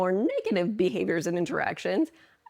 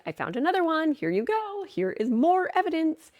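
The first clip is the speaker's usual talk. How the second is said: much higher, a chirpy little helper voice; eager brain voice; 10 semitones higher than usual. Acted. The brain proudly presenting more evidence, high chirpy helper voice. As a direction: play the brain as an eager assistant handing over proof. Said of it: "your brain is like".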